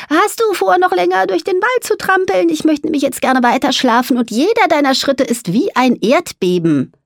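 high-pitched, fussy indignation; grumpy mole, complaining. Acted. Mole wants to sleep despite dragon's trampling, complaining.

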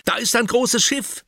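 hoch und laut vor Aufregung; aufgeregter Schrei. Acted. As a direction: ruft begeistert ein Schiff aus. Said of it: "schreit Oliver aufgeregt". (Oliver entdeckt ein Schiff, ruft aufgeregt)

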